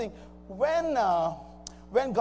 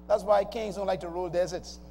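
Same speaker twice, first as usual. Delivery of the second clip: ordinary talk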